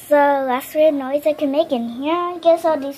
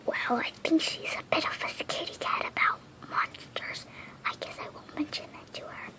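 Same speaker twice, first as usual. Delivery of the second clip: secretive whisper; 2 semitones higher, 14 dB quieter than usual. Acted. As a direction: whisper it so she can't hear. Secret aside so she won't hear.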